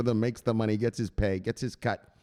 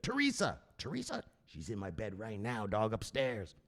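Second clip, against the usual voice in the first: much softer secretive boast; hushed brag; 3 semitones lower, 11 dB quieter than usual. Acted. Telling his buddy she's upstairs, hushed boast.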